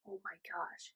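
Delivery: very soft, low stunned reaction; stunned hush. Retold or imitated. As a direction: say it under your breath, stunned.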